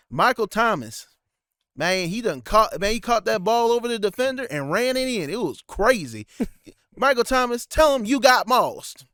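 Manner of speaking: hammed-up country announcer accent; thick country accent. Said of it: "that thick ass accent".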